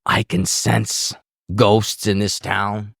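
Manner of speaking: slow, spooky mock French accent for the superstitious guitarist; a lightly done French accent, eerie and drawn out; bad French accent. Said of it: "he's f-, very heavily French, so sorry for my bad accent".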